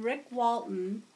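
ordinary delivery

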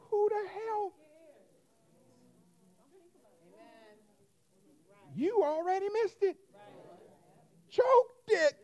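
high anxious voice; hammed-up self-doubt; mock panic. Acted inner panic.

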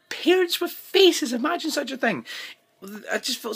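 exaggerated sarcastic wonder. Real, not acted. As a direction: marvel at it mockingly. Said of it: "repeated for effect".

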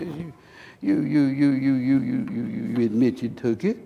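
soft stutter on repeated you; imitated stammering forgiveness. Robbed man answering the thief's confession, stammering softly. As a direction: retell a stammered acceptance, hushed.

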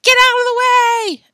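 very high, loud alarmed yell; full-voiced panicked shout. A narrator's reading. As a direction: yell a warning to everyone.

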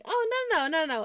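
high, quick no no no; emphatic denial. Real, not acted. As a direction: rush to correct them. Quick denial to a chat question.

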